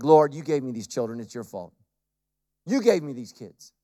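low, mildly weary grumble to God; lightly acted low complaint; mock parental complaint.

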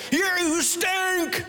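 put-on high, slow child taunt; a boy jeering at his toys; lightly voiced kid's taunt. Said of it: "He had little teams".

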